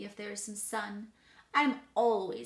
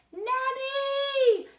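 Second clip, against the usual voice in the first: high, loud, long-held call; tutor screaming; 9 dB louder than usual. A narrator's reading. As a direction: scream for help, holding the note. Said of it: "and then he screams".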